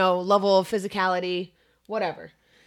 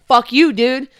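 angry retort; 4 semitones higher, 9 dB louder than usual. Retold or imitated. Repeats insult to opponent.

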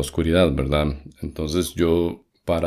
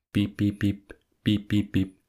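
higher, soft, even electronic beeps; phone beep imitation; 3 semitones higher, 4 dB quieter, slower than usual. Showing how the lost handset sounded, imitated beeping. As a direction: beep like a cordless phone locator. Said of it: "el teléfono donde estuviera sonaba".

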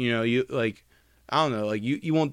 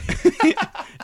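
high burst of laughter; 6 dB louder than usual. Real, not acted. Friend admits faking, cackling laugh. Mocking friend's faked listening.

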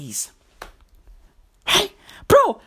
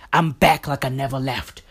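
rapper boast; 11 semitones lower than usual. Retold or imitated. Mimicking every rapper's comeback brag, a low lazy hint. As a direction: lightly voice a rapper's comeback line. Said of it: "every rapper in the world has said".

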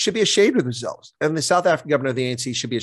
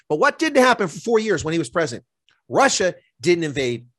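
normal talking voice

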